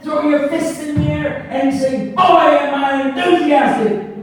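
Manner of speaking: high, loud, hyped-up motivational rallying; Carl Luther. Retold or imitated. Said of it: "You want to be enthusiastic".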